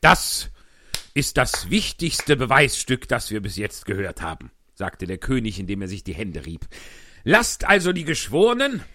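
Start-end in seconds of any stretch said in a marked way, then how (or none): 0.0-4.5 loud, high blustering king; pompous king. Acted. 7.2-8.8 loud, high blustering king; pompous king. Acted.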